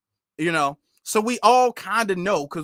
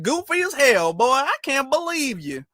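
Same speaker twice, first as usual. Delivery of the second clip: Looney Tunes take on Southern; cartoonish fake Southern accent; 6 semitones higher than usual. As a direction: imitate a bad Southern accent, pitched way up. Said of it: "You sound like a Looney Tunes cartoon".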